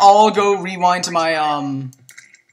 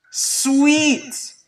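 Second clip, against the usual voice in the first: usual speaking voice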